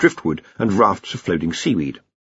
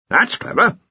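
put-on high, hearty old wizard voice; blustering archchancellor; 11 semitones higher than usual. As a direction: boom it out like a bluff old boss. Ridcully speaks again.

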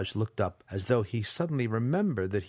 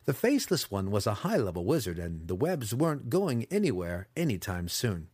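usual speaking voice

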